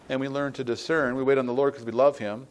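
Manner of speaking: ordinary talk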